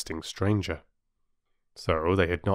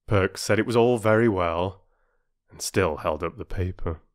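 usual speaking voice

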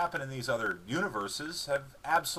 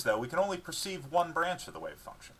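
ordinary delivery, nothing marked

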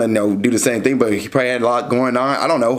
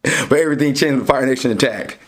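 dramatic narrator voice. Pretend. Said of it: "I'm, I'm playing".

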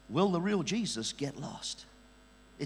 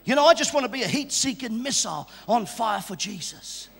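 ordinary delivery

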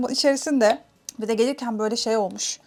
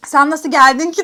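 louder, higher question through laughter; laughing retelling; 4 semitones higher, 7 dB louder than usual. Retold or imitated. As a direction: quote his question while cracking up. Adamın sorusunu gülerek aktarıyor. (gülerek, yüksek sesle aktararak)

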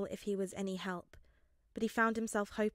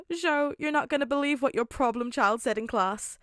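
higher, louder, far-off father voice; fond father; 5 semitones higher, 9 dB louder than usual. A narrator's reading. Father telling his son about his favourite student, fond. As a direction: tease it like a proud dad.

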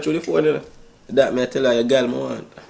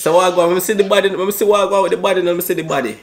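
put-on Jamaican accent; 7 semitones higher, 3 dB louder, faster than usual. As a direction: fake Jamaican accent, high and loud. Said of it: "my Jamaican accent".

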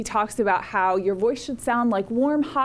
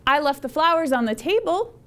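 statement turned into a question, higher pitch rising on "table"; uptalk; 4 semitones higher than usual. Shown as a demonstration. Teacher showing the common end-of-sentence habit, uptalk. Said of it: "we tend to go up at the end of sentences".

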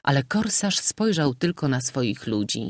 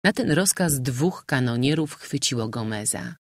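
ordinary delivery, nothing marked

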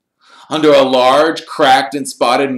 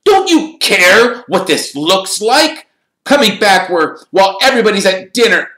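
loud, high-pitched indignant outburst; scolding; 8 dB louder than usual. Acted. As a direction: tell them off loudly, voice climbing. Percy scolds, Ron starts arguing back.

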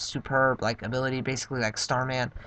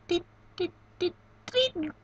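very high, electronic beeps; computer beeping. Acted. Streamer voicing the computer sending, high beeping. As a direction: chirp like a little machine sending data.

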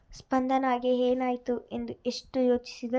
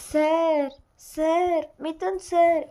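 high, loud narrated cry for help; screaming out; 5 semitones higher, 5 dB louder, much slower than usual. A narrator's reading. Woman finding the phone screams for her boss, acted screaming out. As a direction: cry out higher and louder to summon him. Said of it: "ಎಂದು ಕಿರುಚಿಕೊಂಡಳು".

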